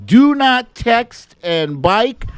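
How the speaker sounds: drawn-out high, slow warning voice; hammed-up slow-motion caution; mock slow-mo warning. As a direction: stretch it like a slowed-down public service ad. Jokey slow-mo safety warning.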